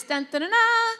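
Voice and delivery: high, loud sung trumpet fanfare; hammed-up trumpet call; mock fanfare. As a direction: toot out a grand fanfare.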